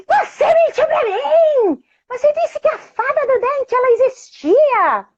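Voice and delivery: put-on very high, slow, shouted child's outrage; furious accusation. Daughter yelling at mother that she lied about the tooth fairy. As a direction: hurl it at mum like a betrayal.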